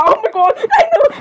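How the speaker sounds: high-pitched giggly squeal; giggling. Acted. Girls reacting to his voice, giggling. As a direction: squeal it through a fit of giggles.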